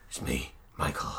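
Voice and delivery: husky whispered ghost voice; weird whispery Michael voice. Retold or imitated. Medium faking a possessed ghost, husky whisper. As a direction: rasp it out like a fake possessed medium. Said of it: "in this weird voice".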